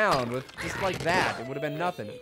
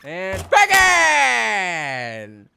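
long drawn-out bacon shout, climbing higher; bacon yell; 5 semitones higher, 10 dB louder, much slower than usual. Acted. Gamer yelling a silly catchphrase, drawn-out shout. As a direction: stretch it out and yell it.

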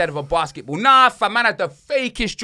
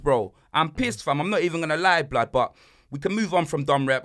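ordinary talk, nothing marked

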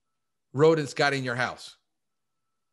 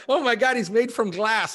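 mock panicked parent; 9 semitones higher, 5 dB louder than usual. Imitating nervous first-time parent.

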